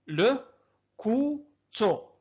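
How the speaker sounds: كوتو drawn out very slowly; slow teaching split; syllable by syllable. Shown as a demonstration. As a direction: stretch كوتو long after لو. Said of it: "أعيدوا".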